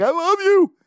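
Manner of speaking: high, loud blubbering wail; mock sobbing cry. Wishing to cry for Brooks, high loud mock wail. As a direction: sob it like an overcome fan. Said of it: "start snotting all over myself and be like".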